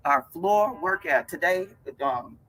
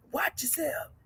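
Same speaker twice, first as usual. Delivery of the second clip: high unvoiced whisper; whispered warning; 10 semitones higher, 9 dB quieter than usual. Warning her viewers about a dangerous emotion in a whisper. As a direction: hiss the warning softly. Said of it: "whispered warning".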